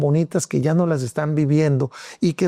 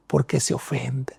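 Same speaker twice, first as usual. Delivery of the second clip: whispered aside; 3 semitones lower, 5 dB quieter than usual. Real, not acted. To listeners about the offended, whispered mock. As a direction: drop to a whisper, mocking the easily offended.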